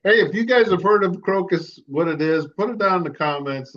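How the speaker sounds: viewer appeal. Acted. Cohost asking viewers to comment, announcer-like. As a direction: pitch it to the viewers.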